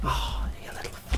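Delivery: whispered, cut-off swearing; hushed, breaking off mid-word; muttered curse. Real, not acted. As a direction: curse under your breath. Cursing at game under breath.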